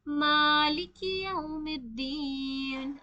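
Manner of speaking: मालिक यौमिद्दीन drawn out slowly, lips rounded on यौ; slow recitation. Shown as a demonstration. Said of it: "यहाँ पे यौ आया है".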